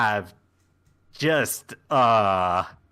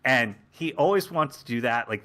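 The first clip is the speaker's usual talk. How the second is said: normal talking voice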